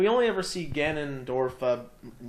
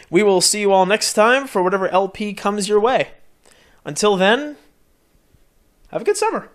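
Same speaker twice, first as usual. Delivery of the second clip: louder, formal host voice for the farewell; sign-off announcer; 4 semitones higher, 10 dB louder than usual. Acted. Host closing the final episode to viewers, announcer sign-off. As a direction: address the audience like closing a show.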